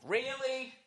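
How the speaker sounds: high, thin whining plea to God; whiny complaint to God. Retold or imitated. Alone in the garage complaining to God, high whiny voice. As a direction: whine up at the sky like a wronged child. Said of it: "I start doing that".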